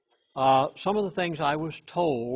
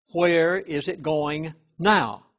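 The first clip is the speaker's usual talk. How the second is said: "now" drawn out and pushed, louder at the end; stress on now; 3 semitones higher, 6 dB louder, slower than usual. Retold or imitated. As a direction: lean slowly into the final "now". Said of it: "And I emphasize the word now".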